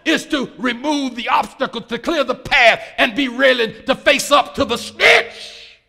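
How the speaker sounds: loud, high-pitched preacher's climax; full-force preaching. Acted. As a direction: drive it home loudly, drawing out the end.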